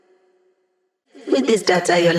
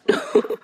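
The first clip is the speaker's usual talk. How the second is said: laugh. Acted. Short laugh in game.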